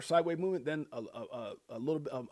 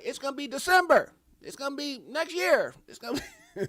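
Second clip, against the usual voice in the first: higher, louder mock voice of doubters; 10 semitones higher, 6 dB louder than usual. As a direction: ham up their endless excuses, pitched up. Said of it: "They're like".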